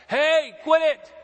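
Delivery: loud, high, drawn-out angry yell. Retold or imitated. People in the house yelling up at the roof, shouting. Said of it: "people must have yelled up".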